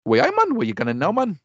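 hammed-up Geordie greeting; put-on Geordie; Geordie accent. Shown as a demonstration. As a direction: attempt a rough Geordie accent. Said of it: "I do Geordie".

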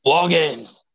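lordly grandeur announcing War Games; booming, stately British announcer voice; William Regal. Retold or imitated. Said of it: "You gotta say it like William Regal".

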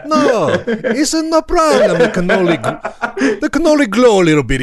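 high, hammed-up Italian accent. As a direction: wave it away like a comic Italian. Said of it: "the Italian accent is approaching".

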